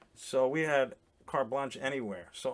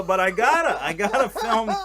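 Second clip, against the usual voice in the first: laughing; 7 semitones higher, 9 dB louder than usual. Real, not acted. Laughing while retelling filming.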